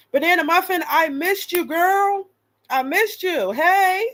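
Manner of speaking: high, bright-eyed hello to a fan; excited greeting. Real, not acted. Streamer greeting a returning fan, high and excited. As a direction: gush over a returning viewer.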